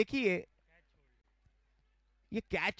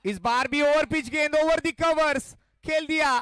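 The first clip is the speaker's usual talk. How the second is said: excited cricket commentary; 5 semitones higher, 4 dB louder than usual. Real, not acted. Boundary hit, live call.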